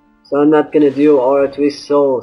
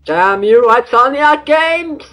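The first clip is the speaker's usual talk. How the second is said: mock dramatic curse; 9 semitones higher, 3 dB louder, slower than usual.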